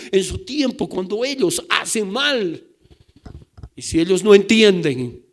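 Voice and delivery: loud, driving pulpit delivery; full-force preaching. Acted. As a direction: hammer the congregation with urgency.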